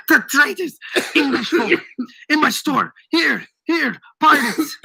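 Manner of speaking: high-pitched mock scream for help. A narrator's reading. Old shopkeeper calls for help against pirates, screaming. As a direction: raise the alarm in high exclamations. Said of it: "began to scream".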